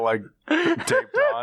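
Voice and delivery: high, slow words through laughter; laughing. Real, not acted. Storyteller cracking up at the mannequin's taped face.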